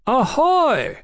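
high hailing shout; sharp, high-pitched sailor's call; brusque shout. A narrator's reading. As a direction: hail them brusquely from above. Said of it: "came a brusque shout".

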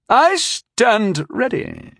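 high, slow, vicious declaration. A narrator's reading. Knight drawing his sword to duel, vicious. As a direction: draw the words out with menace. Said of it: "he said viciously".

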